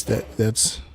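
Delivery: ordinary talk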